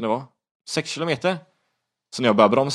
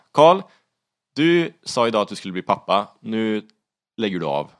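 retold self-directed shout; 3 semitones higher, 6 dB louder than usual. Rider screaming at himself inside his helmet, retold shouting. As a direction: yell the name, then ease into a stern scolding. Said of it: "jag sitter och skriker innanför hjälmen".

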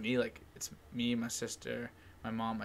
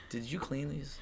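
ordinary delivery

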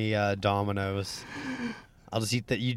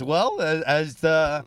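mock pompous diplomat; 5 semitones higher, 5 dB louder than usual. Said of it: "the first thing you say".

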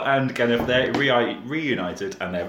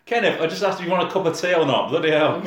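mock exasperated friend; 7 semitones higher than usual. Mock exasperated person beside Kenneth.

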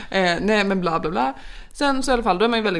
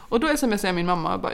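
usual speaking voice

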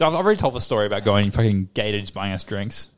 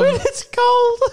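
very high, louder mock squeal; mocking echo; 5 dB louder, much slower than usual. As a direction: parrot your friend in a teasing falsetto. Mockingly echoes friend's story.

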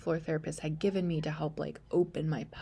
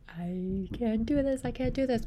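desperate plea; 5 semitones higher than usual. Retold or imitated. Despairing in labor.

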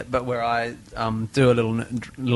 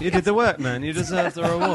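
laughing; 7 semitones higher than usual. Real, not acted. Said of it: "laughing teasing".

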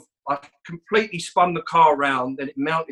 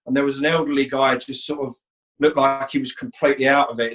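usual speaking voice

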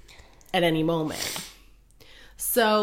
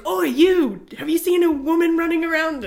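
high, loud searching call; shouting priests; 10 semitones higher, 6 dB louder than usual. Retold or imitated. Priests hunting the runaway, high loud call.

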